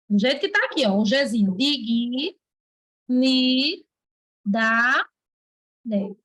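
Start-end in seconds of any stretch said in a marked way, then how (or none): 1.6-6.1 "Di, gui, ni, da, de" with pauses between syllables. Shown as a demonstration. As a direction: dictate "Di, gui, ni, da, de" piece by piece. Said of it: "pensa que ela se separa assim".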